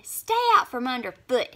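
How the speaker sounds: loud sharp snap; snapping. A narrator's reading. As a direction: bite the words off angrily. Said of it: "he snapped".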